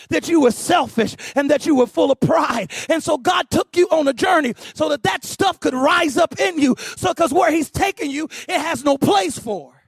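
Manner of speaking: driving sermon delivery; impassioned preaching. Real, not acted. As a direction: press the point home to the congregation. Preaches at full force.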